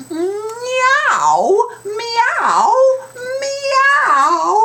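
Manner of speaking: very high, loud meows; meowing cats. Acted. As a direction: meow like a cat. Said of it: "opened their mouths wide and said".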